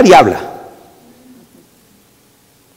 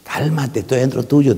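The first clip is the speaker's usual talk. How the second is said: God answering in a much softer voice; softly; 3 semitones lower, 8 dB quieter than usual. Retold or imitated. Preacher voices God calmly answering the shouter, softly. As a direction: hush them gently, close and softly. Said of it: "te dice despacito Él".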